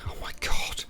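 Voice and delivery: exasperated whisper; whispered aside. Real, not acted. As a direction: whisper it under your breath. Muttered aside while reading article.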